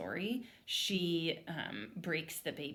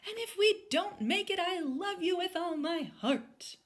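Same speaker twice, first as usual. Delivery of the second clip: melodramatic farewell; 12 semitones higher, 5 dB louder than usual. A narrator's reading. Mom fleeing, hammy high farewell to her family. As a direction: ham up the last goodbye.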